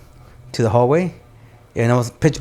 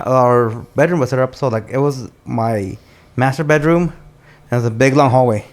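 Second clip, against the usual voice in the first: usual speaking voice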